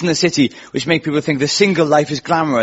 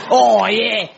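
higher-pitched mock Essex "oh, yeah"; Essex accent; 5 semitones higher than usual. Shown as a demonstration. Comic practising a TV guest's Essex accent. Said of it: "I've got to get the accent".

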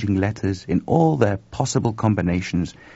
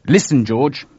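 higher, louder urgent appeal; 5 semitones higher, 6 dB louder than usual. A narrator's reading. Chuck warns his colleague George of trouble, urgent. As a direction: grab his attention urgently. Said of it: "said Chuck urgently".

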